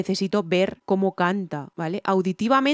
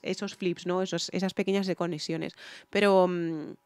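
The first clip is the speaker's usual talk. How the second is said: ordinary delivery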